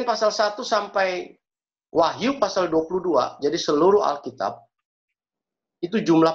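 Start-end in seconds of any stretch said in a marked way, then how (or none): none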